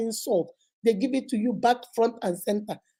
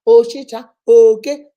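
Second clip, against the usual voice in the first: sudden loud insult yells; shouted Igbo insults at a partner; 12 dB louder, slower than usual. Retold or imitated.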